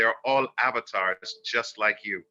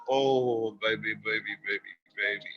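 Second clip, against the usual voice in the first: hushed, much softer rocking croon; soothing spirits; 4 dB quieter than usual. Acted. Ancestral spirits soothe the viewer like a baby, hushed. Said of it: "spirits rocking you, telling you".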